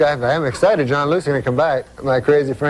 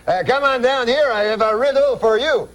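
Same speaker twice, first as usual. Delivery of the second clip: higher calling voice, "Mary Claire" up; calling out excitedly; 8 semitones higher than usual. Retold or imitated. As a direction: call her from the door, eager. Uncle calling wife through house.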